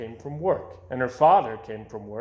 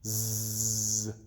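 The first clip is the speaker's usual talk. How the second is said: snore; 3 semitones lower, 6 dB quieter than usual. A narrator's reading. Amy sleeps.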